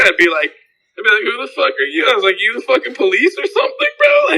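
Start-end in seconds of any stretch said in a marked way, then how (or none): 1.2-2.1 high-pitched indignant comeback; retold defiance, pitched way up; defiant retort. Acted defiant retort to smoking objector. 2.4-4.4 high, incredulous mock challenge; pitched-up retold indignation; indignant retort. Acted defiant retort to smoking objector.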